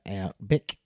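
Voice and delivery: "bick" for back, short and tight; Aussie "out, bick" with a clipped end; clipped Australian vowel. Shown as a demonstration. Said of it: "quip up the, uh, the shorter, uh, moments".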